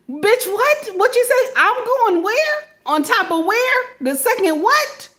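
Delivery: high-pitched comic disbelief; incredulous. Acted. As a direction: question it as a preposterous order, voice way up. Imagines being sent onto the roof.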